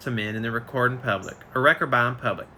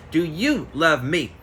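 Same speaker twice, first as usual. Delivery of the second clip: slow, each word set apart, stress on "you"; slow pointed question; 2 semitones higher, slower than usual. Retold or imitated. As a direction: spell it out slowly to make him answer. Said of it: "I said with an empathetic point".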